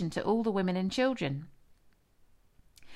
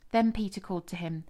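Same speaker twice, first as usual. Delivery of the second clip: usual speaking voice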